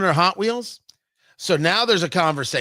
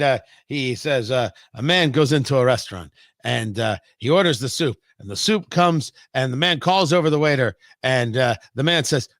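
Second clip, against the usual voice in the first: ordinary talk, nothing marked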